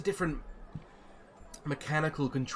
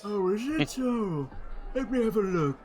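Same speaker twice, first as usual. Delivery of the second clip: put-on old gnome Silas; 9 semitones higher, 5 dB louder than usual.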